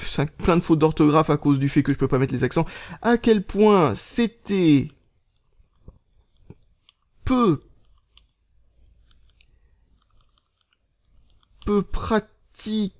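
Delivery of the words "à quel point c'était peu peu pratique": very slow, long gaps between words; typing aloud. Real, not acted. Vlogger typing a tweet on tiny keys, halting dictation. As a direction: stretch the words while hunting for keys.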